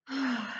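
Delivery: wistful little sigh; soft sigh. Acted. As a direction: breathe out longingly. Arthur sighing wistfully.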